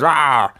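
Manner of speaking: short mock monster roar; imitated monster roar. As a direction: pop up with a quick "rah" roar. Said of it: "pops up at the end and goes".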